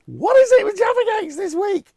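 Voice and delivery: loud, high-pitched cry of comic disbelief; mock-exasperated outburst. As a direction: shout it way up high, playing exasperated. Exasperated at yet another Jaffa question.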